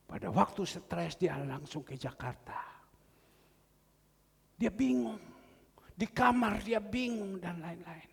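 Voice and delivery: ordinary delivery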